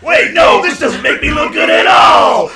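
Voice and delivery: high, loud mock outrage; indignant protest. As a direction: shout in protest, played big. Villain protests at being impersonated.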